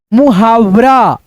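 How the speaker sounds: मुहावरा read slowly and clearly. Shown as a demonstration. Teacher reading a word list slowly. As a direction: stretch मुहावरा out for beginners. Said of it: "reading word list".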